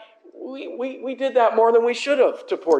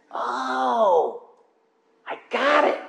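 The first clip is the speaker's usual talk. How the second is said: realizing; 5 semitones higher, much slower than usual. Retold or imitated. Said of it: "see in people's eyes".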